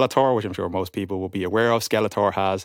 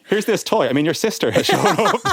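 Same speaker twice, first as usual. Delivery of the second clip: mock marketing voice; 11 semitones higher, 4 dB louder than usual. Mock toy company voice.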